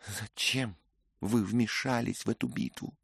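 ordinary delivery

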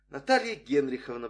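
ordinary delivery

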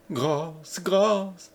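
high, mock-sobbed begging; plaintive, acted sob; sobbing plea. A narrator's reading. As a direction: plead as if through tears. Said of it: "sanglota l'épouse martyre".

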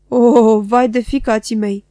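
put-on old man bellowing his woe; old man roaring. A narrator's reading. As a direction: roar the lament as he grabs the coat. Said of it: "a răcnit bătrânul".

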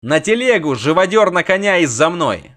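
loud shouted orders; rally the men, loud; shouted command. A narrator's reading. Bogold gives orders.

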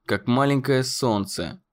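ordinary delivery

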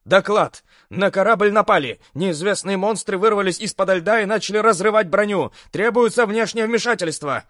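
high, urgent pilot near shouting; almost shouting a radio report; pilot over the radio. A narrator's reading. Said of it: "гляди, перейдёт на крик".

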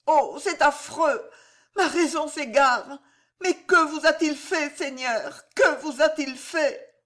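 high, anguished plea; horrified pleading. A narrator's reading. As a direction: plead in horror, voice pitched high. Wife horrified, pleading with lord.

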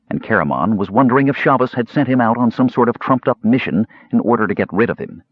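usual speaking voice

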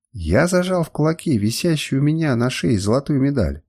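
plain reading voice

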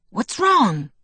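high-pitched urgent question; demanding. A narrator's reading. Jacob senses Bella's worry, demanding. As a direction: demand to know the trouble. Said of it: "he demanded".

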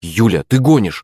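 ordinary delivery

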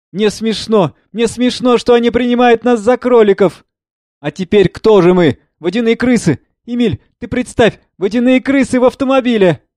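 high, excited near-hysteria; laughing over her fear; hysterical giggling. Acted. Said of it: "Смеётся". (истерично-весело)

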